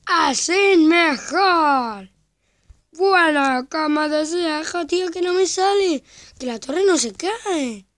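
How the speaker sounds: loud, high, drawn-out yelling; deliberate shouting. Shown as a demonstration. Young gamer proving viewers can hear, shouting. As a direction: shout it extra loud so the microphone picks it up. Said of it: "Voy a gritar más".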